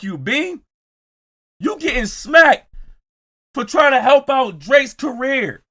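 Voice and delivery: high, loud taunting holler; hollered, high-pitched taunt; loud call-out. Real, not acted. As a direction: shout it at him like a warning. Calling out Bean, taunting him.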